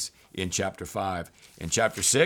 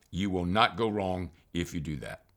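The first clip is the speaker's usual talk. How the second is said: normal talking voice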